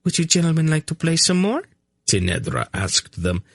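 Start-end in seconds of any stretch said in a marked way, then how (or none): 0.0-1.6 high, quick, playful challenge; smug teasing. A narrator's reading. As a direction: invite them smugly to try again. Smug princess teasing the two men.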